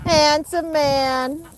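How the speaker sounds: slow high cooing. Real, not acted. Cooing at goose.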